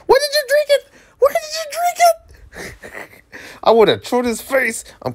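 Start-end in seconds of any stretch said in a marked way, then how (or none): 0.1-0.9 very high squeaky voice, way up. Pretend. Streamer squeaks at the game's gross drink. 1.2-3.2 hammed-up slow, very high sob; mock crying. Streamer fake-sobs over the gross drink.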